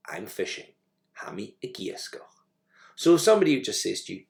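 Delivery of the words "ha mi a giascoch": soft model phrase. Shown as a demonstration. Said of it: "teacher models Gaelic phrase".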